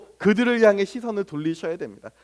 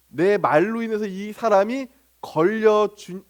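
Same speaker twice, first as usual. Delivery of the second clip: ordinary delivery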